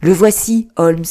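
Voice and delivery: like finding the treasure at last; cry of triumph. A narrator's reading. Watson to Holmes on finding the name, triumphant cry. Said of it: "Je poussai un cri de triomphe".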